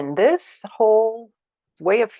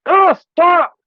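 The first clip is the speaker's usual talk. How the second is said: higher, louder, sharp oh stop; angry stop; 11 semitones higher, 8 dB louder than usual. Shown as a demonstration. Teacher acting out anger at intrusion: loud sharp stop. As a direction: snap it out to block an intrusion. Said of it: "'cause I'm like".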